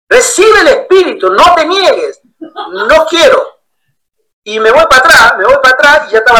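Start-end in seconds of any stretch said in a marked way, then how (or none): 0.1-2.2 high, pressing preacher call; insistent preacher. Retold or imitated. Pastor insisting the newcomer receive the Spirit, high pressing call. 2.4-3.6 slow, stretched plea; drawn-out refusal. Retold or imitated. Newcomer refusing the pastor's hand, slow stretched plea.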